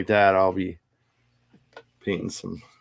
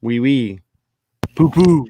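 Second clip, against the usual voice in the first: put-on French accent; 3 semitones higher, 5 dB louder than usual. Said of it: "he's speaking French".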